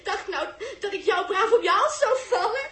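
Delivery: scornful laughing taunt; mocking laughter. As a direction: laugh in his face as you mock. [name] mocks Dirk, laughing.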